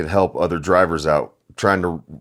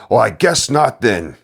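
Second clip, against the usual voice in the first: retold scream; 5 semitones higher, 5 dB louder than usual. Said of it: "snatches it from me and screams".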